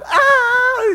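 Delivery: very high, loud mock shriek; imitated panicked scream. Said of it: "And Ella's going".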